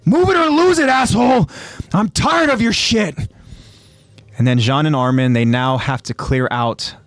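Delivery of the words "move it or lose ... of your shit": high, exasperated yelling; angry yell. Acted. Jean fed up with Reiner, angry yell. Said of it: "he yells out to Reiner".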